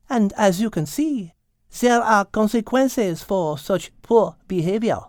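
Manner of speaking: put-on high French-accented lady cat, composed reproof; Mademoiselle. Cat excusing a catnip accident, high French-accented reproof.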